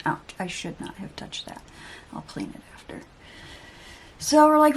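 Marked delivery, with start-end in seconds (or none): 0.1-3.0 real whisper, much softer; a quick pained aside under the breath. Real, not acted. Aside after touching sore spot.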